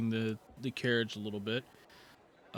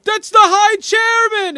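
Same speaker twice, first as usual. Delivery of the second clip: very high, loud, slow cry of joy; excited shout; 19 dB louder, slower than usual. Acted. Citizen recognising the chairman, excited shout. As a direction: shout it to the whole crowd. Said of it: "It's like".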